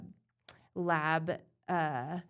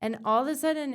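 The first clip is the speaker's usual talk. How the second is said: ordinary delivery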